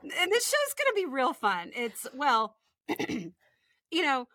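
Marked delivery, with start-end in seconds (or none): none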